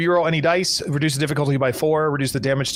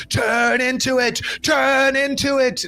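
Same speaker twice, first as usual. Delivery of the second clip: high, drawn-out yell; stretched-out storm holler, high in pitch; sailor yelling orders; 10 semitones higher, slower than usual. A narrator's reading. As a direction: bellow orders slowly over a storm. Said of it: "he's yelling".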